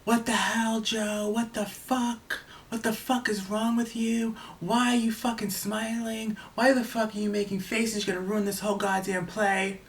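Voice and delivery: high-pitched mock scream of furious outrage; retold screaming. Director yelling at Joe from the audience, high mock scream. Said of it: "She started screaming at me".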